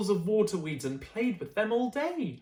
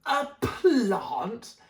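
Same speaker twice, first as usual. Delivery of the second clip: horrified yell; 4 semitones higher, 3 dB louder than usual. Acted. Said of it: "yelled Mum in horror".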